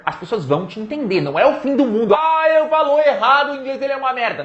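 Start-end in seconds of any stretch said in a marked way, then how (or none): 2.1-4.4 higher-pitched mock outrage at bad English; mock critic. Teacher mimicking someone judging a learner, mock-horrified. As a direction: make fun of a fussy critic, pitched up.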